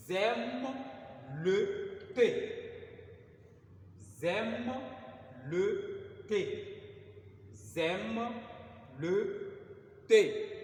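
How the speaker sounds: "J'aime le thé" repeated loud, "thé" hit hardest; drilled translation. Shown as a demonstration. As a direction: drill the French translation, stressing the article. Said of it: "teacher repeats the translation". (« J'aime le thé » répété fort, appuyé sur « le »)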